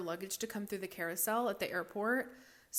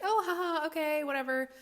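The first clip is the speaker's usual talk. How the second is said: dismissive; 8 semitones higher, 5 dB louder than usual. Retold or imitated. Retold dismissive reply to warning.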